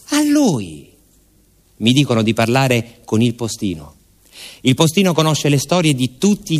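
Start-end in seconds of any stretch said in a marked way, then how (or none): 0.1-0.9 much higher, emphatic pointing out; insistent villager. Acted.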